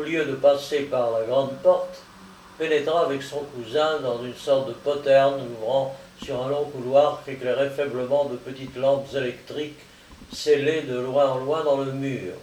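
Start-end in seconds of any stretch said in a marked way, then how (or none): none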